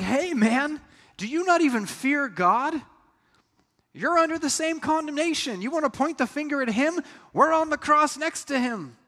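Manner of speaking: a dying man scolding the mocker beside him; rebuking fellow criminal. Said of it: "He's like".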